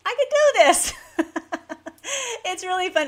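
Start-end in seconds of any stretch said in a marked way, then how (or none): 0.1-0.9 high, loud burst of glee breaking into laughter; real giddy triumph; delighted, laughing. Real, not acted. Delighted after repeating Spanish phrases. 1.0-2.4 high, giddy peal of laughter; real high-pitched giggling; delighted laugh. Real, not acted. Laughs after trying the Spanish.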